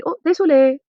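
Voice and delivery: high, loud startled sorry; quick apology. Shown as a demonstration. Teacher acts bumping into somebody, high and loud. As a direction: apologize after a bump. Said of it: "if you bump into somebody".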